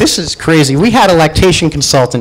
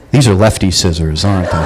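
sheepish realization; 13 semitones lower than usual. Retold or imitated. Dawning realization told to nurse.

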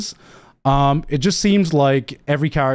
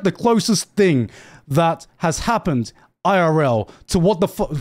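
usual speaking voice

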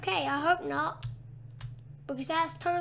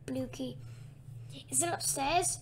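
usual speaking voice

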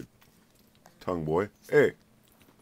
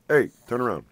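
loud, higher call to get attention; 7 semitones higher, 10 dB louder than usual. Real, not acted. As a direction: shout it so the pet turns round. Calling pet to turn around.

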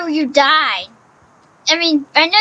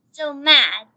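ordinary talk, nothing marked

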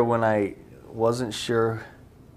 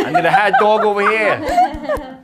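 high, loud, slow Boston holler; hammed-up Boston street shout; brash Boston accent; 11 semitones higher, 11 dB louder, slower than usual. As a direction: yell it like a pushy Bostonian. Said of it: "I don't even know if it's Boston".

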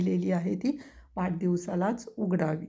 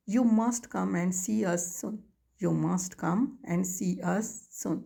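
each word spaced out for writing; slow dictation; much slower than usual. Shown as a demonstration. Teacher dictating to students, very slow word by word.

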